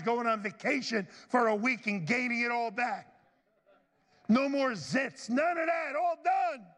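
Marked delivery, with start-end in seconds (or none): 4.3-6.7 rising higher into a joyful shout; exultant. Real, not acted. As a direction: build up and cry it out in triumph. Preacher building to exultant climax.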